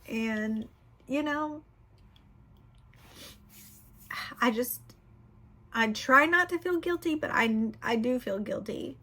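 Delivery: speaking through tears, trying to hold it together; emotional confession on camera, crying. Real, not acted. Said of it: "I just started blubbering with y'all".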